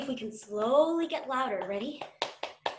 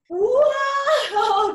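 loud high whoa; teacher amazed at wiggly kids; mock amazement; 10 semitones higher, 9 dB louder than usual. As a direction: act wowed by the snakes.

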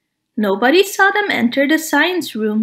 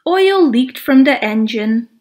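ordinary delivery, nothing marked

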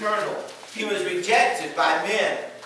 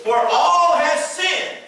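high and slow, full-force sermon reading; fiery preaching; 6 semitones higher, 4 dB louder than usual. Real, not acted. Pastor reads Romans to congregation, fiery preaching. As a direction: preach the verse and let it ring.

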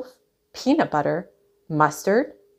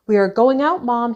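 raised, higher-pitched call to Mom; 5 semitones higher, 5 dB louder than usual. A narrator's reading. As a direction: call to someone in the next room. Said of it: "he called".

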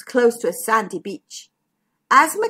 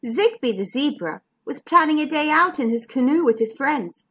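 plain reading voice

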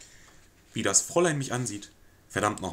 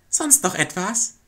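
higher, slow servant's question; the maid, politely detached; maid's voice; 10 semitones higher, 8 dB louder, slower than usual. Acted. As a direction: ask coolly as the maid. Maid asks curtly.

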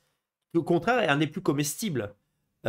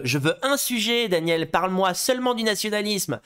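lightly voiced indignant viewer, higher pitch; mock demanding nationalist; 5 semitones higher than usual. Nationalist viewers complaining to the host, mock demanding. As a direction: imitate a fussy militant's demand. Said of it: "les nations en mode".

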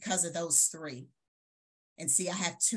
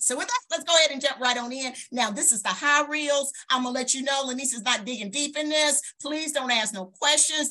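hyped-up; 7 semitones higher, 7 dB louder than usual. Real, not acted. Hyping the audience up.

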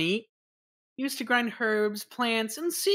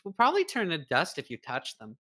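usual speaking voice

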